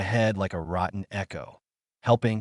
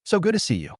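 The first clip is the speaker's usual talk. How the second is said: sugary fake welcome, higher; fake smile; 8 semitones higher than usual. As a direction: sound glad while meaning none of it. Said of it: "he said with a fake smile".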